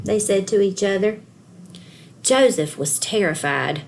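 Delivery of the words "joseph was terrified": ordinary delivery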